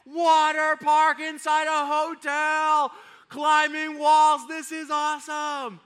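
put-on loud, high, drawn-out cheer of excited kids; imitated children whooping with joy; erupting in enthusiasm. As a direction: shout it like kids thrilled by a surprise. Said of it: "they just erupted in enthusiasm".

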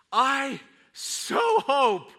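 high, loud, drawn-out longing; acted burst of hopeful wishing; fervent hope. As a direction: stretch each word with big, yearning emphasis. Longing on hearing good news.

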